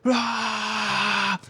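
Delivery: a short high, hammed-up roar of rage; mock devil roar. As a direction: roar it like a cartoon devil freaking out. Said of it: "he's like".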